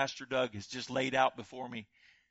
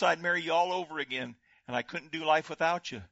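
ordinary talk

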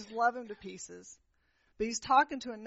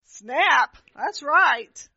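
loud, higher "snap, that's right"; stung exclamation; 4 semitones higher, 13 dB louder, much slower than usual. Retold or imitated. Preacher admitting the Spirit's rebuke, loud exclamation. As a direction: blurt it like you've just been caught. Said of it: "And I went".

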